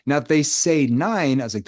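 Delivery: usual speaking voice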